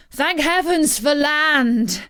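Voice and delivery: high, slow cry of relief at seeing land; relieved cry. A narrator's reading. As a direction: call out in relief, drawn out. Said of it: "cried the men".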